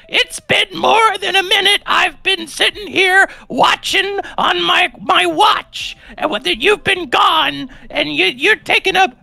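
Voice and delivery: put-on high, slow, screechy old lady yelling; hammed-up high old woman voice; cranky old woman. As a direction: shriek it like an angry old neighbor. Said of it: "She goes".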